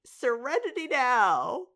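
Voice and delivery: loud, high, drawn-out mock yell; imitated yell. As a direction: yell it slowly, imitating a stressed-out sitcom character. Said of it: "he would yell out".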